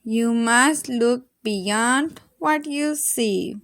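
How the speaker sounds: ordinary delivery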